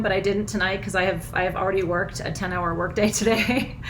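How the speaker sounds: normal talking voice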